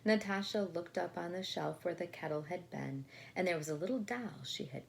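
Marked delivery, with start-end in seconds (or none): none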